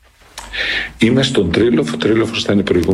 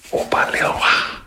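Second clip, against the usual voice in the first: surprised whisper; 3 dB quieter than usual. Retold or imitated. Surprised when called back.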